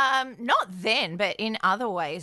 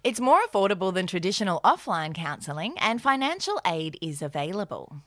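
ad read; 4 semitones lower than usual. Acted. BetterHelp ad read by both.

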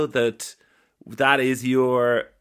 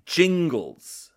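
usual speaking voice

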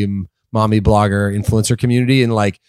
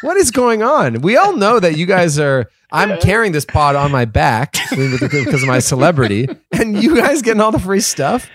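higher, hammed-up wounded outrage; mock hurt; 7 semitones higher than usual. Jealous co-host whining about missing gear, mock hurt. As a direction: play the jilted friend, half laughing. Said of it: "the hurt in your voice".

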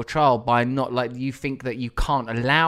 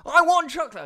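put-on high child voice demanding chocolate; little daughter whining for chocolate; whiny little girl; 4 dB louder than usual. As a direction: play a toddler who wants chocolate. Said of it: "she goes".

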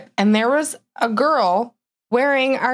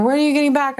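higher-pitched mock nagging; pestering customers; 7 semitones higher, much faster than usual. Customers bugging the owner all summer, mild mock pestering. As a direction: lightly imitate someone bugging you. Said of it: "people bugging me all summer".

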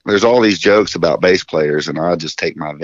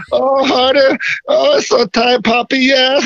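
mock moaning. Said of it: "he's like".

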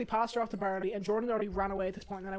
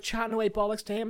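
ordinary delivery

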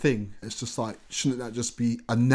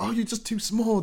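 much higher-pitched voice of self-doubt; discouraging inner voice; 11 semitones higher than usual. Retold or imitated. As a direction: put on a high, belittling inner critic. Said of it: "there was a voice like".

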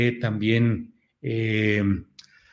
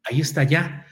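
normal talking voice

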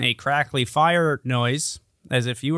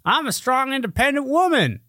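higher mock declaration; mocking quote; 9 semitones higher than usual. Host mocks a slogan-shouting woman, higher mock declaration. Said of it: "The ones that yell".

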